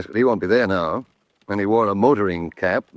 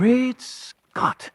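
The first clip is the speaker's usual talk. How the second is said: startled exclamation; 12 semitones higher than usual. Acted. Sudden realization mid-conversation.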